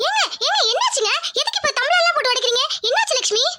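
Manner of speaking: high-pitched, urgent questioning; startled wife demanding what happened; alarmed. Acted. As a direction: rush in alarmed, voice pitched right up. Wife alarmed as things get smashed.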